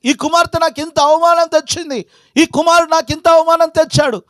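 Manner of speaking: high-pitched, driving pulpit complaint; impassioned preaching. Acted. Preacher voicing parents shamed by their children, impassioned. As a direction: pile up each grievance at a high pitch.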